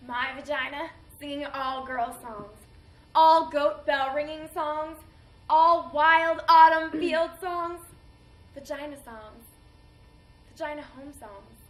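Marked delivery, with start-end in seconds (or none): 3.2-7.8 loud, ringing celebration of songs; exuberant reminiscence. Acted. Woman recalling her songs before the war, exuberant. 8.5-11.6 tender reminiscence. Acted. Woman remembering home songs, tender and soft. Joyful memory fading to tenderness.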